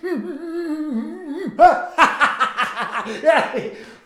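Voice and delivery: mocking laughter. Mocking laughter after taunt.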